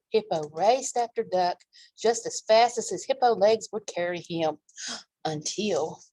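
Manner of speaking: ordinary delivery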